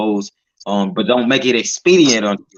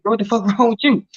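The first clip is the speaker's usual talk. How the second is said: high, half-laughing disbelief; shocked question; 8 semitones higher than usual. Retold or imitated. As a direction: blurt it out stunned, breaking into a laugh. Kid shocked at cousin's pale face.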